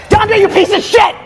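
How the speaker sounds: slow, high-pitched furious shout; demonstrated yelling. Shown as a demonstration. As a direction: show them how to yell at the culprit. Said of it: "I'm gonna show you something".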